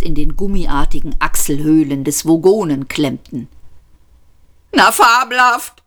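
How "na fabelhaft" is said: high, exasperated outburst; indignant high-pitched sneer; blurted sarcasm. A narrator's reading. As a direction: burst out bitterly with raised pitch. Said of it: "stieß Arthur hervor".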